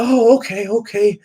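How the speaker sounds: put-on high, pleading old man on voicemail; imitating a lovesick old husband; mock old husband. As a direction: put on a frail, doting old man's voice. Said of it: "he's like".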